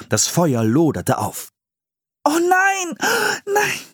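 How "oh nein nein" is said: very high, wailing dismay; children's shrieked alarm, acted; horrified cry. A narrator's reading. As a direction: cry out in horror, pitch way up. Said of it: "schrien die Geschwister entsetzt".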